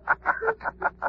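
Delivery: high, ghostly mocking laugh; eerie laugh. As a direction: laugh from nowhere, unseen. Unseen Shadow laughs in room.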